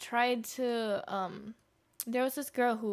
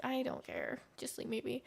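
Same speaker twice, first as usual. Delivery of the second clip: much softer, unbothered; flat dismissal; 9 dB quieter than usual. Retold or imitated. Telling the gossiping crowd she doesn't care, soft and bored. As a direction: mumble it like you've checked out.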